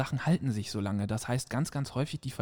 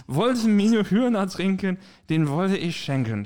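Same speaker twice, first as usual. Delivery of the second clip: slow, slurred, higher and louder; drunken slurring; 4 semitones higher, 4 dB louder than usual. Shown as a demonstration. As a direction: slur it out like a cheerful drunk. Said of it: "Mal gucken, wie gut ich lallen kann".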